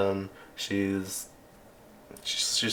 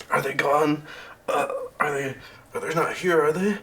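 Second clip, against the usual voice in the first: high, halting nervous questions; worried, pitch climbing at the end; anxious; 6 semitones higher than usual. Acted. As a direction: stammer it out, checking for danger. GM voices Spring waking in fear.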